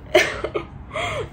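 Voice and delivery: a high cracking laugh that breaks into crying; laughing and sobbing at once, real tears; high, broken laugh caught in a sob; tearful laugh-sob. Real, not acted. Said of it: "I started crying".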